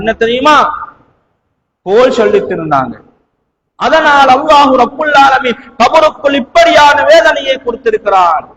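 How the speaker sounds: ordinary delivery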